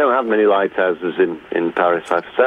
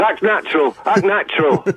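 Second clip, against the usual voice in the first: mock stiff acting; 10 semitones higher than usual. Shown as a demonstration. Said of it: "If I went to go".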